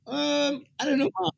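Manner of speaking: high, soft, feigned innocent squeak; mock clueless reply. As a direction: play dumb to mom in a thin high voice. Answering his mother's odd question.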